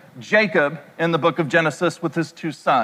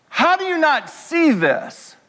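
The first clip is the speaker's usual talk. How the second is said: higher, slow, disbelieving; exasperated plea; 8 semitones higher than usual. Retold or imitated. Son asking his dad in slow, higher disbelief. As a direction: drag out the disbelief.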